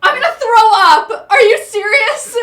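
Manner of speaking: loud, high disbelieving shout; shocked outburst. Real, not acted. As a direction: shout it, appalled. Shocked outburst at friend's claim.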